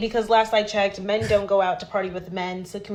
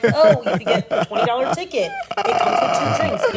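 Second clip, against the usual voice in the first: mock promo voice; 3 semitones higher, 6 dB louder than usual. Mocking the club's ticket offer, laughing mock sales voice. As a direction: sneer at the club's deal.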